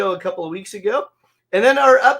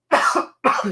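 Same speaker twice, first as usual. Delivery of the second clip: harsh cough, choking on a drink; 7 semitones higher, 3 dB louder than usual. Real, not acted. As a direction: cough as if something went down wrong. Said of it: "Sorry, I'm choking".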